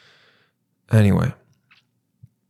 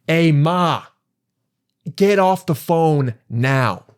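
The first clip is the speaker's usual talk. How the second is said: stern driver order; 4 semitones higher, much slower than usual. Retold or imitated. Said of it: "And she goes".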